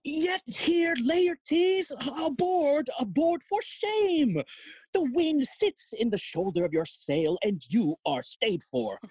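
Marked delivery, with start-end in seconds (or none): 0.0-6.1 high-pitched scolding call; chiding father calling out to his son; urging him aboard. Acted. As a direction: hurry him along in a high, theatrical voice. Father hurries son onto ship.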